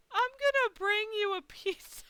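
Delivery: high, emphatic, caring voice; kind promise. Retold or imitated. Front desk lady promising guest pizza, high caring voice. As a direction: promise comfort, pitched right up.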